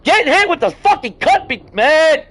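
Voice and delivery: high, loud frustrated yell; loud frustrated gamer yell; angry outburst. Real, not acted. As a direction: blurt it out in real anger at the game. Dying in game, frustrated outburst.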